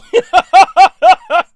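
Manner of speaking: loud burst of real laughter; hearty high laugh; big laugh. Real, not acted.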